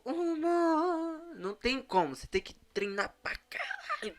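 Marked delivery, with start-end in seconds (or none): 0.0-1.2 sudden leap into high falsetto; voice jumps up. Shown as a demonstration. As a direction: flip up into falsetto. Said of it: "a voz dele faz isso".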